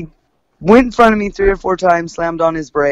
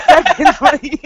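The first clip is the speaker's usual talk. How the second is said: high laughing through the word; real laugh breaking into the retort; 9 semitones higher, slower than usual. Real, not acted. Laughing while retelling own retort.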